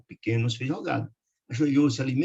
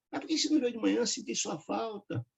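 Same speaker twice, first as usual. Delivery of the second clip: lightly voiced old grandfather; 9 semitones higher, 6 dB quieter than usual. A narrator's reading.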